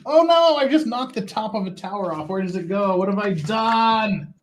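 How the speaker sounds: high, hammed-up wail of dismay; mock panic. Builder who knocked the tower top off, mock panic. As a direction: wail in fake horror, drawing out the end.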